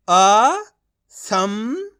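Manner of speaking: slow sounding out of असंभव; drawn-out syllables. Shown as a demonstration. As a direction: stretch असंभव slowly, rising. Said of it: "तो मिलके बन जाएगा". (असंभव को धीरे-धीरे खींचकर)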